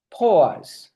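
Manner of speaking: normal talking voice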